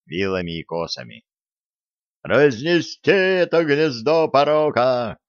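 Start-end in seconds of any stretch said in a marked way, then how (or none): 2.2-5.2 raised, slow rallying cry; mob shout. Acted. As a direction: rouse the crowd.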